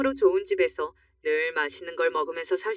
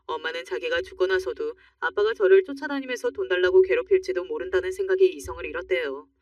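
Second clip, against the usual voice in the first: ordinary delivery, nothing marked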